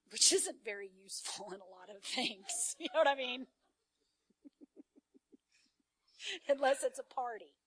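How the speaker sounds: laughing through the words. Real, not acted. Speaker joking to congregation about her partying past, laughing. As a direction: crack up at your own confession.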